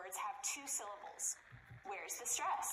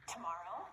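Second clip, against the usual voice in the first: to-MOR-row, stressed in the middle; middle-syllable stress; 4 semitones lower than usual. Shown as a demonstration. Teacher modelling a word to repeat, middle syllable stressed in Tomorrow. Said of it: "It can be in the middle".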